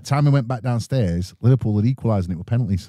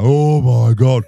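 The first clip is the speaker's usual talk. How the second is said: louder, slow panicked dad from upstairs; a hint of a shaken father; startled dad; 7 dB louder, much slower than usual. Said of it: "I can hear my dad upstairs now".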